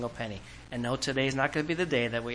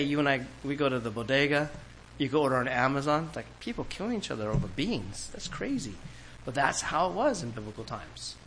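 ordinary talk, nothing marked

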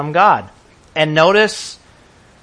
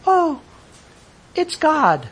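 high, incredulous mock aside; 11 semitones higher, much slower than usual. Joseph scoffing at Mary's story, mock incredulous. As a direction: voice Joseph's disbelief, pitched high. Joseph imagining the absurd excuse.

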